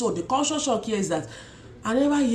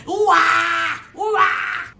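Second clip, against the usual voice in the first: high loud ooh-ah wail; mock tantrum wail of a screaming toddler; imitated wailing cry; 10 semitones higher, 6 dB louder, much slower than usual. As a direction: belt out a child's wailing scream. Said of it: "That's how Lotana was crying".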